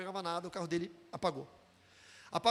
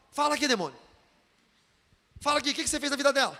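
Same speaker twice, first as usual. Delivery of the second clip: loud, fast mock interrogation; pastor interrogating demon; 3 semitones higher, 7 dB louder, faster than usual. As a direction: imitate a pastor barking orders at a demon. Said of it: "toda hora ele falava assim". (pastor interrogando demônio, alto)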